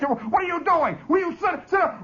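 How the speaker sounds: high-pitched flustered teacher's reprimand; scolding teacher. Retold or imitated. Teacher scolds the charging boy.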